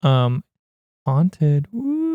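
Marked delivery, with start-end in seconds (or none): 1.7-2.1 high, soft mock-ghost wail; playful drawn-out ghost noise; spooky "woo". Mocking the haunted house.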